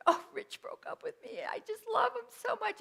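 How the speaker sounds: high, whiny mock-crying teenage voice; imitating teen sobbing. Telling her dad why she is crying, high mock-sobbing voice. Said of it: "and I'm sobbing".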